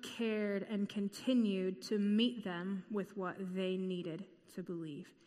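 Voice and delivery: normal talking voice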